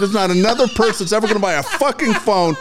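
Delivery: high, exasperated mock rant; imitated chewing-out. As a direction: mimic the coach at the peak of a tirade. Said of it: "he starts giving me shit".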